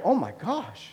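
falling, deflated "Oh my gosh" with a dropping "oh"; exasperated parent sigh. Retold or imitated. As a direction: say it like a stumped, worn-out dad. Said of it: "And I was like".

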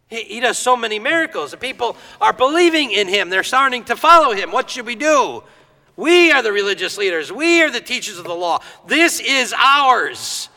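anxious Pharisees. Retold or imitated. Pharisees worrying among themselves about Jesus, rising alarm. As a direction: build the panic of men losing their grip.

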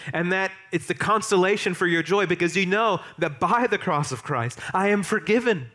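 preaching at full force. Acted. Pastor urging his congregation toward joy in the cross, preaching at full force.

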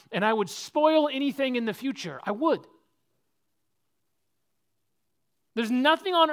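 preacher swearing he'd never trade faithfulness; impassioned preaching. Acted. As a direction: build to a pulpit climax. Preaching climax, loud and high.

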